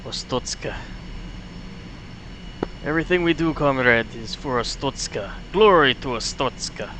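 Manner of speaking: mock Soviet loyalty, quietly; Soviet accent. Pilot pledging loyalty to Ostotska, light Russian accent. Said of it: "Soviet sound voice".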